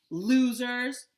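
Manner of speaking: high, loud taunting shout; Henry shouting at the fled bullies; shouted loudly. A narrator's reading. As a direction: yell the insult after them. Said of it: "Henry shouted really loudly".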